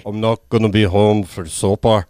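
put-on lightly slurred old drunk Irishman; a loose comic take on a drunk Irish guy; Fitzy. Co-host imitating a movie character's accent, hint of drunk old guy. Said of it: "sounds like an old drunk guy".

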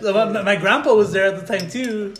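normal talking voice

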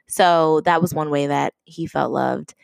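normal talking voice

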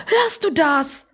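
high, slow, drawn-out question; urgent question. Acted. Oma asks just-woken Opa about knocking, high and urgent. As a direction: press him to listen, pitched high.

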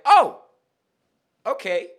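loud, high-pitched taken-aback reaction; mock shock. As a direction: burst out startled, then accept it. Retold reaction to insult.

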